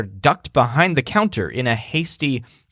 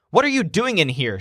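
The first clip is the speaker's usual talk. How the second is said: higher, quick, indignant demand; a restrained yell, pitched up and hurried; mild yell; 6 semitones higher, faster than usual. Acted. Shopkeeper catches the cheaters in her store, mild yell. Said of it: "she yells".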